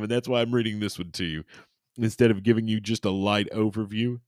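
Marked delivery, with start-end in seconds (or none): none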